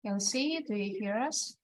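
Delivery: ordinary talk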